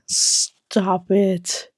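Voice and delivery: normal talking voice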